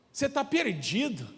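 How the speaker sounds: a high, gloating "Você tá perdido?"; gleeful mocking. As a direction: tease him, delighted he's lost. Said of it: "com a maior alegria assim que ele tava". (amigo zombando, todo alegre: "Você tá perdido?")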